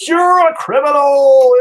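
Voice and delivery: high, loud, slow mock outrage at the criminal; mocking critic. Mocking Facebook critics who call him a criminal, high and loud. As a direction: ham up an outraged online critic. Online critics mocked as shouting.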